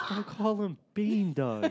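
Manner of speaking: high, slow laughing protest. Real, not acted. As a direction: laugh through the complaint. Laughing protest over nickname.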